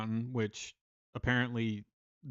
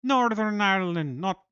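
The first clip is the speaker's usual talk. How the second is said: a mock Northern Irish accent, a bit higher and louder; 5 semitones higher, 5 dB louder than usual. Streamer greeting a Northern Irish viewer in a mock Northern Irish accent. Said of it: "I hope you don't find that accent offensive".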